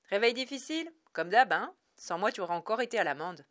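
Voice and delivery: loud, fast, gloating fat coworker; pompous gloating. Acted. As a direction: crow it out loud and quick. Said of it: "pérora le gros et gras".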